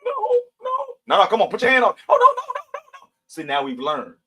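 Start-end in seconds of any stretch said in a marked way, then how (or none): 0.0-1.9 put-on high, loud, panicky child's plea; frightened kid pleading. Kids refusing grandma, a scared high-pitched plea. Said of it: "And you know what we would say?". 2.1-3.1 put-on high, loud, panicky child's plea; frightened kid pleading. Kids refusing grandma, a scared high-pitched plea. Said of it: "And you know what we would say?".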